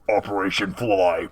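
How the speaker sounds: put-on voice announcing a launch; mech command voice. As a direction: call it out as the villain's battle command. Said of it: "he'd be like".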